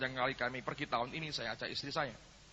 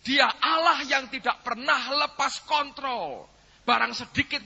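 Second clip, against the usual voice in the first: loud, raised-pitch sermon delivery; full-force preaching; 11 semitones higher, 12 dB louder than usual. Real, not acted. Preacher explaining his experience to congregation, forceful preaching. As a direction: drive each point home to the congregation.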